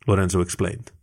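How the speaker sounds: ordinary delivery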